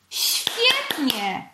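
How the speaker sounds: high, delighted approval; praising. A narrator's reading. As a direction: cheer on a good attempt. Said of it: "pochwalił go mędrzec". (z zachwytem i pochwałą)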